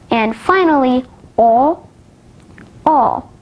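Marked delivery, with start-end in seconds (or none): 1.4-3.3 long "all" as a bare vowel, as in draw; held "all" without the l, the vowel of taught; long vowel demo. Shown as a demonstration. Teacher showing learners long vowels, "all" as a held aw. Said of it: "Now let's move on to long vowels".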